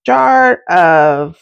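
ordinary delivery

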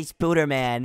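Spooderman, first vowel as a silly "oo"; funny mispronunciation. Shown as a demonstration. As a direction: say Spooderman with an "oo" in place of "Spider". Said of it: "it's funnier when I say Spooderman".

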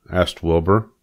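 usual speaking voice

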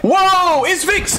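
high, delighted shout of welcome; excited greeting. Real, not acted. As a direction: hail a friend with a big whoa.

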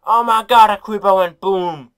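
loud, high startled yell; startled shout. Real, not acted. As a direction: shout in sudden shock. Creeper explodes in game.

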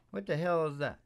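ordinary delivery

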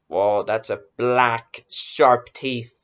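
ordinary delivery

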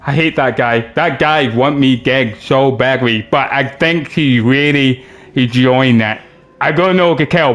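ordinary delivery

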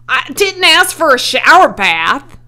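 high, loud indignant complaint; exasperated retort. A narrator's reading. Soaked owner answers his helper, loud indignant retort. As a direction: fire back in loud protest.

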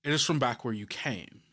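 usual speaking voice

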